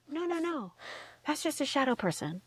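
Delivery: soft, high hushing reassurance; soothing brain. Acted. Brain covering up a frightening sight, mock soothing. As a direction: play the brain gently talking someone out of a fright.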